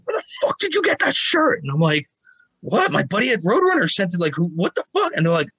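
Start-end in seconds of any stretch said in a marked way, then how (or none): 0.0-1.6 much higher, confrontational strange voice; aggressive demand. Retold or imitated. Two guys in his face. 2.6-5.1 higher, flustered bewildered pushback; startled protest. Retold or imitated. Thrown into the wall, confused.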